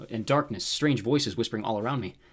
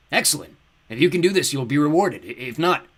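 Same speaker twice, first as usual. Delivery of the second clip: loud, raised, imperious delivery; fiery villain lord; 4 semitones higher, 8 dB louder than usual. A narrator's reading. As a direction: pronounce it like a dark overlord. Dark lord promises reward, threatens.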